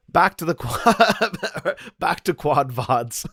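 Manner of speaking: helpless laughter breaking up the words; high, loud, slow words through laughter; real laughing fit. Real, not acted. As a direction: crack up mid-phrase and restart. Cracks up at quad vods.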